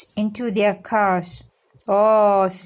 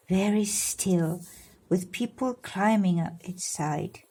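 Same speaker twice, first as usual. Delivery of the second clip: ordinary delivery, nothing marked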